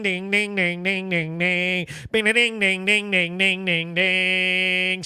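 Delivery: soft, low sung ding-ding banjo riff; mouthed banjo imitation, low and soft; imitating a banjo tune. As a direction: hum out the Deliverance banjo lick quietly. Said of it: "Play us that Deliverance song".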